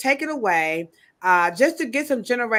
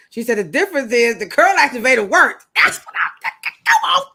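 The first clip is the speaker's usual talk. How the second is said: excited; 3 semitones higher, 6 dB louder than usual. Real, not acted. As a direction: burst out in triumph. Excited agreement.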